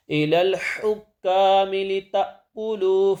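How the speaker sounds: solemn recited verse; Quran recitation. Acted. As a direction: chant the verse slowly and carefully.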